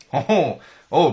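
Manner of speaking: usual speaking voice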